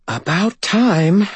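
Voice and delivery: slow, high, strained spirit voice; drawn-out ghostly rasp; whispering spirit. Acted. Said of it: "Bob whispered back".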